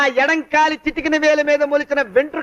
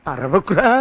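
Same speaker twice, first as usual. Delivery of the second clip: much lower urgent shush; low hush; 10 semitones lower, slower than usual. Acted. Debtor shushing the shouting blackmailer, low and urgent.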